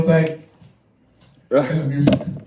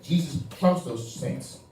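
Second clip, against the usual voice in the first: tipsy, words running together; 4 semitones lower, 6 dB quieter than usual. Real, not acted. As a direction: slur it like you've been drinking. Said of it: "I'm slurring my words".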